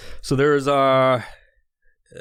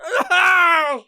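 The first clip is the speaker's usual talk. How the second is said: loud, high pleading yell; shouting in real revulsion; disgusted shout; 4 dB louder, slower than usual. Real, not acted. Disgusting jelly bean eaten.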